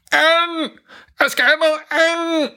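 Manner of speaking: high, garbled desperate cry; burnt-tongue shout. A narrator's reading. Dad running at Santa, garbled high cry. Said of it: "råber faren".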